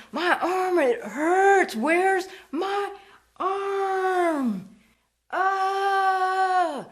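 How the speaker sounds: high, loud, fake cries of pain over a lost arm; mock agonized screaming. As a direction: wail it like a hammed-up ghost in agony. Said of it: "they started in with the screaming".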